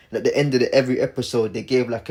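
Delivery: ordinary talk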